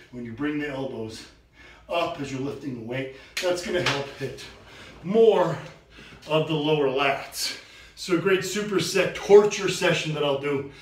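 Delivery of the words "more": MORE punched loud and high; stress on more. Acted. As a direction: hit the word more hard. Contrasting upper and lower lats.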